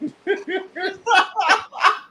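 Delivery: high, soft mock-maniacal giggle; put-on high, creepy little laugh; imitated gleeful giggle. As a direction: giggle like a child enjoying mischief too much. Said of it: "she was over there like".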